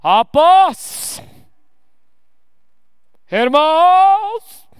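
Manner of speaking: drawn-out, booming mock preacher call. Mocking pastors who put on a voice, slow and loud. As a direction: parody a pompous preacher's voice. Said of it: "quem faz voz".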